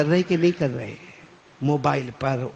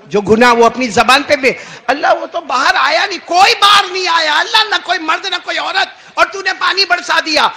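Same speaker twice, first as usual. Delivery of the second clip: high, loud protest to God; indignant cry; 11 semitones higher, 10 dB louder than usual. Acted. Moses protests to God, loud high cry. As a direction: Moses demands an answer, shouting.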